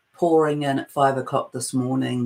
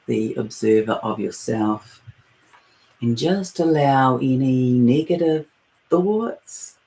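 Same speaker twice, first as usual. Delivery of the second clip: guided meditation; 2 semitones lower than usual. Acted. Leading listeners into their breath and heart space, guided meditation. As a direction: ease listeners inward, phrase by unhurried phrase.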